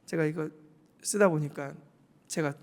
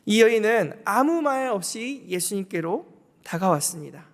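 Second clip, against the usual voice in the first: normal talking voice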